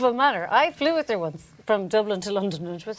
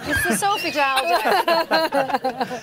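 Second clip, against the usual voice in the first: drawn out; 7 semitones higher, 5 dB louder, much slower than usual. Real, not acted. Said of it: "It's what?".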